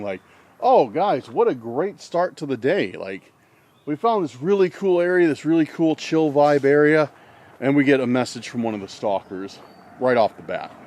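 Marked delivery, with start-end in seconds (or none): 0.6-7.1 bright, higher gushing praise. Retold or imitated. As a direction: rave about the spot, all upbeat. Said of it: "I was just complimenting".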